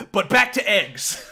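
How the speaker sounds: loud, high, slow mock-Chinese accent; hammed-up Chinese accent. Streamer repeating the shopkeeper's egg line for fun, loud Chinese accent. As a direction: blurt it in a caricatured Chinese accent, drawn out. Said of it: "racist Chinese accent".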